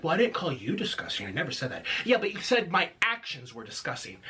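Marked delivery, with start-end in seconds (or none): 0.0-1.8 mocking low imitation. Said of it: "he's like".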